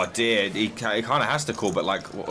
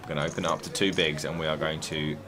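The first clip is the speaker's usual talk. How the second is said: hushed poker commentary; 2 semitones lower, 5 dB quieter than usual. Acted.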